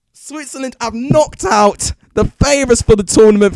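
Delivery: screaming high and loud with joy; ecstatic yelling. Real, not acted. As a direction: scream it, your team just won. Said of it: "sorry if I rip headphone users".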